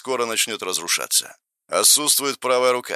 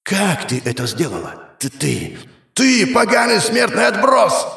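furious outburst; 10 semitones higher than usual. Acted. Goddess rages at mortal.

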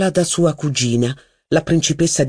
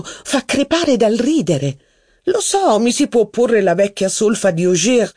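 higher, lively society duchess; a duchess holding court, higher; haughty duchess; 5 semitones higher than usual. Acted. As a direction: gossip grandly about her cousin's wife. Duchess voiced in reading.

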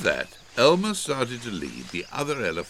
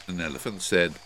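usual speaking voice

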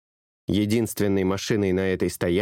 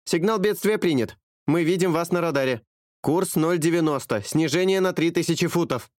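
higher, steady dispatcher voice; calm professional; 8 semitones higher than usual. A narrator's reading. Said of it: "её голос был спокойным, как у профессионала". (спокойный голос профессионала-диспетчера)

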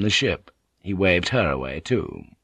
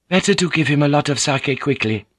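Mariko's voice; 6 semitones higher than usual. Acted. Mariko greets Blackthorn, higher courteous lady's voice.

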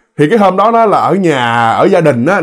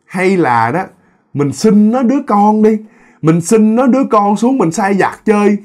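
higher, slightly drawn-out scheming voice; high-toned spirit woman; 5 semitones higher than usual. Acted. High-toned spirit asking her companion for the child, pitched up. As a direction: propose it in a raised pitch. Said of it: "cái bà tông cao đó là bả mới nói".

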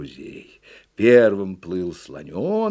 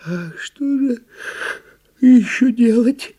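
high, drawn-out drowsy question; slow drowsy turtle; sleepy turtle; 13 semitones higher, 8 dB louder, slower than usual. A narrator's reading. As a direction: answer sleepily as a little turtle, getting louder. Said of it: "сонно ответила черепаха".

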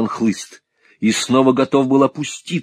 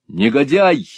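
loud, high, indignant cry; wrathful raised-voice retort; angry shout; 5 semitones higher, 7 dB louder than usual. A narrator's reading. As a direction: fling the insult back angrily. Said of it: "гневно крикнул в ответ Николас".

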